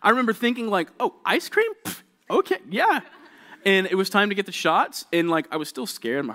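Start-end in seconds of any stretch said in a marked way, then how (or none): 1.0-3.0 put-on high, delighted squeal of a kid hearing ice cream; a child suddenly thrilled, pitched way up; high eager kid voice. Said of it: "my whole attitude changed".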